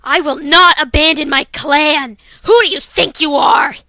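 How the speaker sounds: furious refusal. Acted. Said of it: "here's Dawn Flash".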